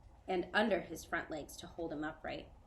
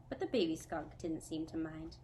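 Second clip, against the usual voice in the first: plain reading voice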